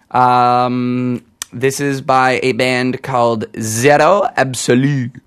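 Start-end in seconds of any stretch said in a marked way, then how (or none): 3.6-5.2 French accent. Shown as a demonstration. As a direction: say Zero Absolu in a French accent. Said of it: "with my awesome French accent".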